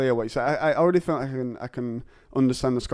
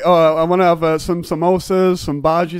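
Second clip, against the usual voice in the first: put-on jokey Indian accent, higher and louder; mock Indian accent; 4 semitones higher, 8 dB louder than usual. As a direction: ham up an Indian accent ordering food. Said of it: "It almost sounds Indian".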